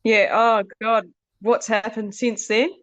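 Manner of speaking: normal talking voice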